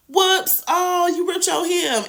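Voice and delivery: high, mock-sweet oops; sugary fake sorry; fake innocent whoops. Cressida fake apology, high.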